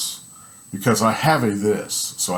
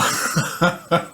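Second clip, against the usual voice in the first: laugh; 7 semitones higher than usual. Real, not acted. Laughing at the loser.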